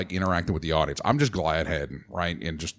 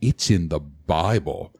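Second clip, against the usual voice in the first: solemn knight; 4 semitones lower, slower than usual. Retold or imitated. Costumed knight quoting scripture.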